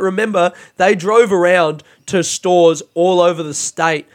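ordinary talk

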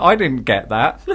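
ordinary talk, nothing marked

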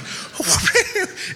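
a high burst of giggling; high giggle. Real, not acted. Preacher recalling launching lemons, giggling high. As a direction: giggle at the memory.

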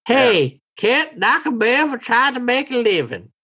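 put-on high-pitched old hustler defending himself; old townsman, protesting. Old man defends his sales trick.

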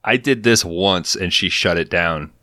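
ordinary talk

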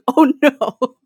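a high, loud mock "Oh, no."; mock terrified. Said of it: "He was like".

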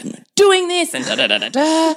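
mock cranky outburst that jumps in pitch; cranky tantrum. Mother imitates her son stomping his foot, mock cranky tantrum. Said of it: "gone into cranky land".

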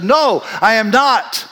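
high, drawn-out, emphatic denial; vehement denial. Acted. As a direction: deny it forcefully, stretching the words. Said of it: "immediately he starts cursing".

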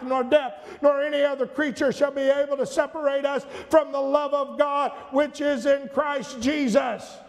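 preacher building to a climax; full-force preaching. Acted. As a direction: thunder the scripture to the congregation.

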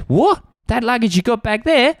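high-pitched, incredulous broad Australian; Australian taxi driver. Retold or imitated. Passenger mimicking the cabbie's reaction, exaggerated Australian. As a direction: put on a very Australian accent. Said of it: "the most Australian man".

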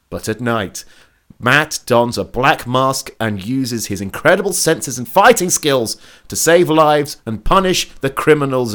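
lightly dramatic TV recap read; recap narration. Acted.

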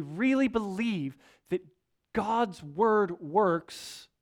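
very slow, each word weighed; preacher spelling out his key point very slowly; slow emphasis. Real, not acted. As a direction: drag out "God's word works" for weight. Preacher driving home his point.

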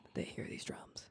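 soft suspense whisper. Acted. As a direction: murmur it in hushed dread. Suspense in drum scene.

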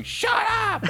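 high yelled shut up; mock shout. Annoyed stranger in front yelling back, high mock shout. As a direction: bark it like an annoyed moviegoer. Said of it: "someone in the front started shouting".

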